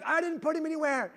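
high, defensive mock yell; screaming back. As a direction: imitate a wife protesting across the house. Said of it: "We're just screaming all over the house".

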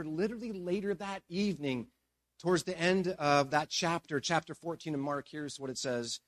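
ordinary talk, nothing marked

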